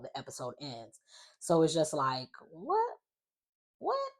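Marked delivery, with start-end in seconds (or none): 2.5-4.1 very high squeaky rising what; stunned disbelief. Real, not acted. Vlogger reacting to the shock ending, stunned high squeal.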